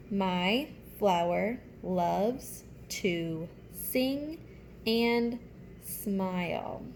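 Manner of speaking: slow, drawn-out read-along; reading aloud slowly. Shown as a demonstration. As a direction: read each word slowly for little kids. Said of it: "I'll go ahead and read it to you".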